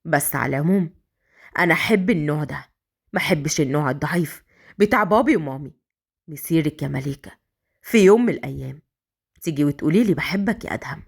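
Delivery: low, scheming male character voice; sly. A narrator's reading. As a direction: plot to yourself, pitched low and crafty. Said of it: "وكمل بمكره وقال".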